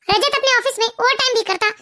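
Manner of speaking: ordinary talk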